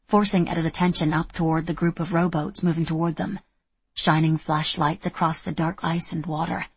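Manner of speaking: ordinary delivery, nothing marked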